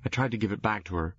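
plain reading voice

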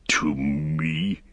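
breathy unvoiced whisper of an old wizard. A narrator's reading. Saruman whispering to Gandalf in disbelief. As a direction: hush it, barely voiced. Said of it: "said Saruman".

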